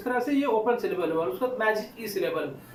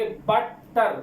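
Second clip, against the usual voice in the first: बटर slowly split into two beats, बट and टर; syllables sounded out; much slower than usual. Shown as a demonstration. Teacher showing students how to count syllables, बटर sounded out slowly. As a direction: break बटर firmly after बट. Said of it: "ज़ोर से बोलिए".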